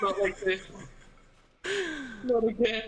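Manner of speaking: loud mock despair; hammed-up anguish.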